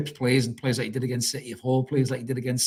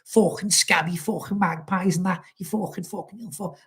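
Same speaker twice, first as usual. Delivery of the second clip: higher-pitched mock fan rant, fading to a mutter; ranting rival fan; 7 semitones higher than usual. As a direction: imitate a furious rival fan swearing. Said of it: "They'll be throwing all sorts of shit".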